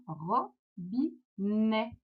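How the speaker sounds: "Robinet" very slowly, ro... bi... net; split into syllables. Shown as a demonstration. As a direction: pause after each syllable of "Robinet". Teacher splits word into syllables.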